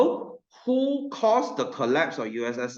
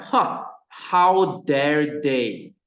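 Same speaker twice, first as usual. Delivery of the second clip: slow, louder offended huh; playing the jealous neighbor, slow and louder; mock outrage; 2 semitones higher, 6 dB louder, much slower than usual. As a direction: drag out the indignant huh, a bit louder. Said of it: "you're like".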